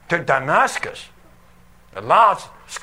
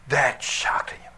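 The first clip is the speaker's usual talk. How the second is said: hushed, breathy aside; whisper; 4 semitones lower, 6 dB quieter than usual. A narrator's reading. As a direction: whisper it as if awestruck. Dramatic hushed narration.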